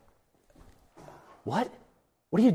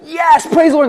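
very high, loud joyful shout; joyful praise; 13 semitones higher, 7 dB louder than usual. Retold or imitated. Healed man running through town praising, high and loud. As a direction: burst out praising.

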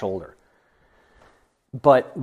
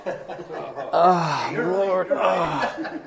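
groaning contrition; 5 semitones higher, much slower than usual. Acted. Mock contrite groaning to the Lord.

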